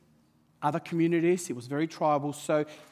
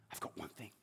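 much softer, almost to himself; quiet thought; 9 semitones lower, 23 dB quieter than usual. Retold or imitated. Preacher voicing the boy's thought, much softer. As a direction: hush it like a secret idea.

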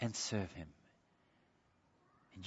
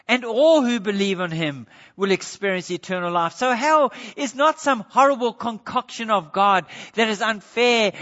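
loud, high pulpit preaching; fiery sermon delivery building to a loud close; preaching at full force; 9 semitones higher, 12 dB louder than usual. Acted. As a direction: thunder it from the pulpit.

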